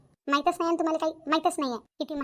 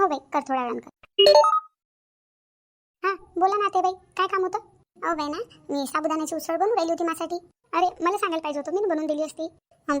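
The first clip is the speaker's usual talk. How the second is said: ordinary talk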